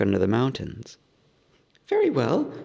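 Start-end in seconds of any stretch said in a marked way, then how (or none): 1.9-2.5 lightly higher hobbit voice; agreeable hobbit. A narrator's reading. Bilbo agreeing to the riddle game, nervous. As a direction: agree quickly, a touch nervous. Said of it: "said Bilbo".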